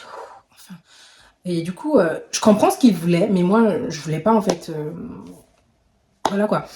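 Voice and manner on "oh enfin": barely breathed, unvoiced and soft; relieved whisper. Whispering her relief as he lets go. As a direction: sigh it out under your breath.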